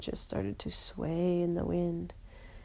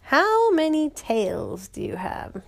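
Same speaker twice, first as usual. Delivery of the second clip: higher, louder, slow riddle question; troll asking; 8 semitones higher, 7 dB louder, slower than usual. Acted. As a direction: pose the riddle grandly as the troll. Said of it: "the troll said".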